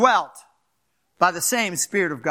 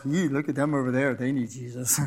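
mock smug piety; 7 semitones lower, 4 dB quieter than usual. Mocking a judgmental believer.

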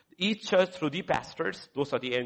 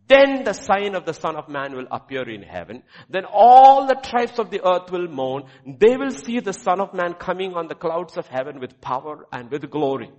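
proclaiming scripture; 3 semitones higher, 11 dB louder than usual. Acted. Preacher to congregation, booming scripture reading. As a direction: declare the verses to the whole church.

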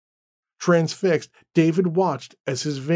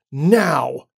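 short, louder command; yelled command; 5 dB louder than usual. Acted. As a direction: snap one final word at her. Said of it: "he yelled".

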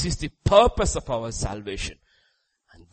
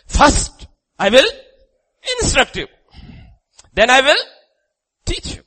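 high, loud bursts with pauses between phrases; loud proclamation; 14 dB louder than usual. Acted. Preacher lays out God's instruction to people, loud proclamation. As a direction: announce each step forcefully, high and loud. Loud, spelled-out announcement to the crowd.

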